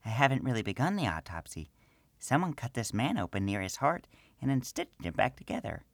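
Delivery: high small gnome woman's voice, matter-of-fact; gnome priestess. Acted. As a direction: speak as the little priestess.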